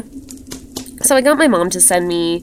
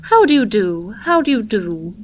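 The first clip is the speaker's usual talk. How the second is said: ceremonious greeting; 2 semitones higher than usual. A narrator's reading. Strange woman welcoming a visitor on the stairs, ceremonious. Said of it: "she murmured ceremoniously".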